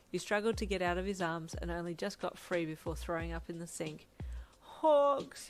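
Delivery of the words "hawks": loud, high, drawn-out call; miserable, whining cry; pitiful wail. A narrator's reading. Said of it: "you wailed pitifully".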